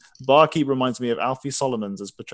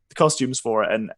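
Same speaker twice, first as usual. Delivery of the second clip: ordinary talk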